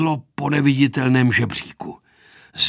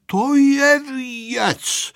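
high, very drawn-out marvelling; long wondering exclamation; amazed neighbours; 11 semitones higher, 4 dB louder, much slower than usual. A narrator's reading. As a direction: marvel slowly in a raised pitch. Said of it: "žasli sousedé".

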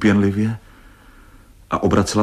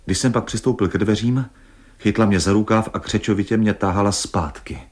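plain reading voice

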